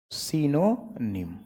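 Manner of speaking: सिनोनिम said very slowly as si-no-nim, each syllable clear; drawn-out सिनोनिम with a short i in the first syllable, not saa; careful model pronunciation. Shown as a demonstration. Teacher models सिनोनिम slowly for the class, careful pronunciation. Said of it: "क्या है बाबू?".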